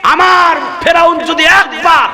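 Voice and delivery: high, ringing preacher's shout; soaring declamation; impassioned proclamation. Retold or imitated. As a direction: proclaim it forcefully as quoted divine speech. Preacher voicing God's emphatic answer.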